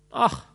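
high, loud wailing sigh; wailing ach. A narrator's reading. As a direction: wail out in misery. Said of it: "jammerte der Flohbändiger".

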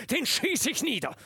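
high, loud menacing warning; angry threat. A narrator's reading. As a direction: threaten them outright. Lieutenant threatens drunk officers.

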